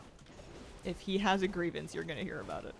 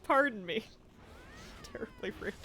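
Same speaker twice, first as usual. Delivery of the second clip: mock posh apology; 5 semitones higher than usual. Mock apology to offended cat.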